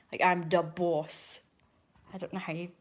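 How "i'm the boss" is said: slow, mock Italian-American "I'm da boss"; lightly hammed-up mob boss line; silly mafia accent. Shown as a demonstration. Vlogger demonstrating how Don Lino sounds, mock Italian-American. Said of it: "I usually just do silly things like dat".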